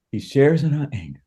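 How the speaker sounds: ordinary talk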